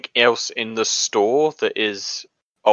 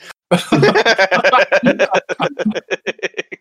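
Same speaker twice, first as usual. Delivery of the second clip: loud laugh; 9 semitones higher, 6 dB louder than usual. Real, not acted. Laughing at the flashback framing.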